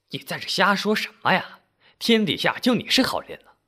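high, indignant rebuke; scolding auntie. A narrator's reading. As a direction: cut him off with a high-pitched telling-off. Auntie cuts off his rant.

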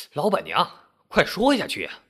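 mild crowd shout. A narrator's reading. Curious crowd urges the landlady on, mildly shouting. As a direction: call out impatiently, without full volume. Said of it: "七嘴八舌地大叫道".

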